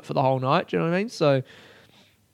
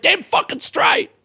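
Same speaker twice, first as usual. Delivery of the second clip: very high, slow screech; hammed-up in-car outburst; angry triumphant yell; slower than usual. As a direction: stretch it into a raging cry. Retold angry outburst alone in car.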